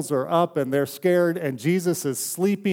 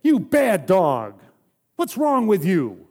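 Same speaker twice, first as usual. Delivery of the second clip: slow, loud, higher-pitched telling-off; mock scolding yell; 5 semitones higher, 5 dB louder, slower than usual. Owner finding the scared dog in the bathtub, mock yelling. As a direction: pretend to yell at a misbehaving dog. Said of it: "Did I yell at him".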